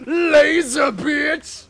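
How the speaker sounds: slow, high, loud drawn-out yell; hammed-up shout; punny battle cry. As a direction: stretch it like a catchphrase. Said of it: "you know how Trick T G says".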